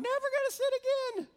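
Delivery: mock high-pitched vow. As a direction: squeak it out quickly in a little-girl imitation. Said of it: "in that high of a voice".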